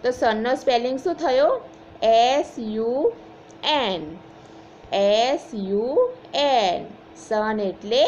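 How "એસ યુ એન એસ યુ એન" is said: slow spelling. Shown as a demonstration. Teacher spells word slowly.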